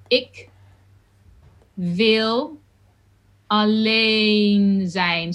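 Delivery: Ik with a closed short i, alleen with a long vowel; careful Dutch demo. Shown as a demonstration. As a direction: stretch alleen zijn slowly. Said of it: "the I is an I, it's quite closed".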